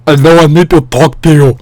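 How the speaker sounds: thick, garbled imitation of a Russian speaker; Russian accent. Stranger approaching him after the meeting, thick mock Russian accent. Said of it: "like, a Russian accent".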